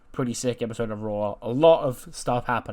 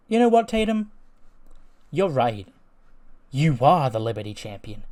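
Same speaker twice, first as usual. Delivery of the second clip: fake sweetness; 3 semitones higher, 6 dB louder than usual. [name] fakes agreement with Tatum.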